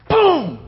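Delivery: loud shouted boom; sudden yelled boom; stone impact. Retold or imitated. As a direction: slam out the sound of a stone hitting. Preacher acts out stoning.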